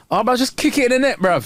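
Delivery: high-pitched armchair-fan heckle; exasperated sports fan. Acted. Acting a fan yelling at players, exasperated. Mimicking fans shouting at players.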